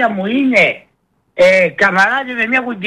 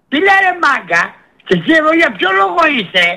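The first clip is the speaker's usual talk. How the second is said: furious shouting; 7 semitones higher than usual. Real, not acted. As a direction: blast the doctors at full volume. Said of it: "Μη φωνάζεις, ρε, μη φωνάζεις". (έξαλλες φωνές)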